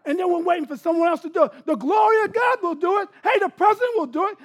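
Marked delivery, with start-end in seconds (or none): 1.6-4.4 shouting, builds to a huge loud final line; rising shout. Real, not acted. Pastor shouting at congregation, building to a loud climax. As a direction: preach it up until the last line booms.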